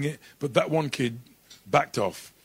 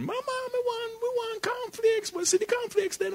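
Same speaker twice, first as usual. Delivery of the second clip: put-on child voice; 4 dB quieter than usual. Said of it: "kids asking mum for cornflakes".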